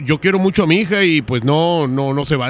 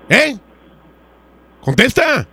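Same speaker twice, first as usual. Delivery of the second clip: demanding; 5 semitones higher, slower than usual. Acted. Prank caller plays angry father.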